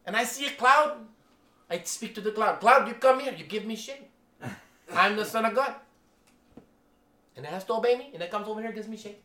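put-on high, animated Filipino English accent, telling a tall tale. The Filipino pastor brags about commanding a cloud, high Filipino accent. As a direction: retell the story as the Filipino pastor in his accent. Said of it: "he used the Filipino accent".

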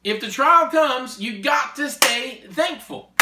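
slow, high and loud; preaching emphasis. Real, not acted. Preacher urging the congregation to stay thankful, slow emphatic. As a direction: drive it home slowly, pitch up.

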